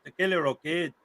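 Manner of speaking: Tequila said with a light Spanish lilt; host shows how to say the name, lightly Spanish accent. Shown as a demonstration. A hint of Spanish accent on the name. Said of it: "you gotta say it with the Spanish accent".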